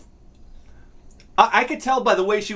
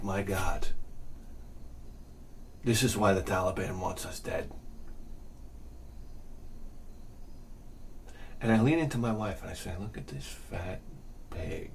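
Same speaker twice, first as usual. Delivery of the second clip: very low, soft confidential murmur; hushed aside; 13 semitones lower, 15 dB quieter than usual. Retold or imitated. Comic confiding to his wife about a stranger, hushed aside. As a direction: drop it low and quiet, deadpan.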